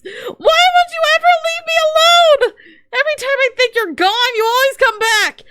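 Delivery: high, loud tearful yell; sobbing shout; crying shout. Real, not acted. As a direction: wail it out, fed up to tears. Frustrated rant at game character.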